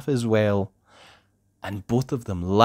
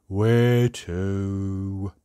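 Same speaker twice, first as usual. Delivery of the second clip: lower, drawn-out sad question; Mister Miserable; 4 semitones lower than usual. A narrator's reading. Miserable lookalike asks where they are going, mournful and slow. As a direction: ask glumly, stretching both words. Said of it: "asked Mister Miserable".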